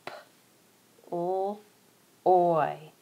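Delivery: P, o, oy said apart; sounding out ploy. Shown as a demonstration. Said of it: "Three sounds".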